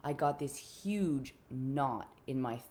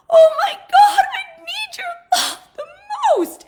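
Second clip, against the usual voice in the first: sobbing scream, much higher and louder; crying shout; 20 dB louder than usual. Retold or imitated. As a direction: scream it while breaking into tears.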